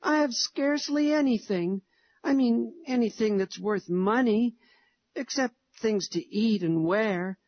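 put-on a little boy holding back tears; tearful boy. A narrator's reading. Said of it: "he sobbed".

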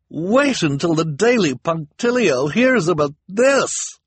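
high, loud, drawn-out gossipy glee; thrilled bearer of bad news; enthusiastic. A narrator's reading. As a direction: gush it loudly and slowly, relishing the news. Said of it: "she said enthusiastically".

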